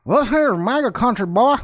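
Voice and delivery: hammed-up, high-pitched bigot voice. Shown as a demonstration. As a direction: exaggerate a menacing Trump fan impression. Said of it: "I gotta get it better".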